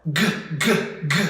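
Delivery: "G" as a hard, voiced "guh"; short hard "G" bursts, not the letter name; isolated G sound. Shown as a demonstration. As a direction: knock out each hard "G" separately. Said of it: "Now the G sound".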